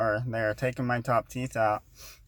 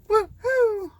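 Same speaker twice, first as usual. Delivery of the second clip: high, loud put-on cheer; forced excitement; 5 dB louder than usual. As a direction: whoop like you're thrilled. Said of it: "I'll try to be ecstatic and excited".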